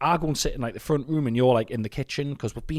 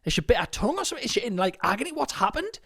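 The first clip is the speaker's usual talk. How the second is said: alarmed retelling; 11 semitones higher than usual. Retold or imitated. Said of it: "and I was like".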